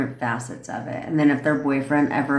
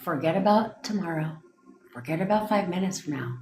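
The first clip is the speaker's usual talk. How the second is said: guie com voz suave e lenta, meditação calma; guided meditation; 4 semitones higher than usual. Acted. Leads a mock meditation.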